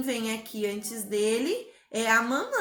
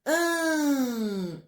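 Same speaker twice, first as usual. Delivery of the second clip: "An" held long, gliding down; stretched syllable. Shown as a demonstration. Teacher isolating the long syllable, "An" drawn out. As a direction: sustain "An" as one long nasal syllable. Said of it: "é uma sílaba comprida".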